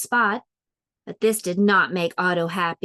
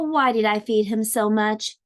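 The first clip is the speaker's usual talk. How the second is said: ordinary delivery, nothing marked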